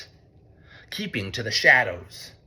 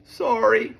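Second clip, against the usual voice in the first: high sorry; 10 semitones higher than usual. A narrator's reading. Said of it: "whispered Mole".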